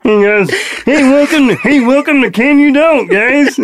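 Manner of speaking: high, pinched frog-puppet voice; Kermit impression. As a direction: greet the crowd like a Muppet host. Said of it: "How's that Kermit impression?".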